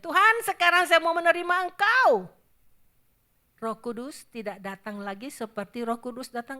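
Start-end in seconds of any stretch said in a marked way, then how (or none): 0.0-2.3 much higher, louder, strained cry to God; desperate cry. Retold or imitated. Preacher acting a left-behind sinner crying out, desperate. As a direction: wail it like a man too late. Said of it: "kalau dia berkata kepada Tuhan".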